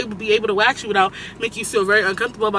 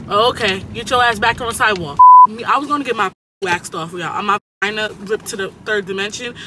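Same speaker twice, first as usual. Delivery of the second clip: usual speaking voice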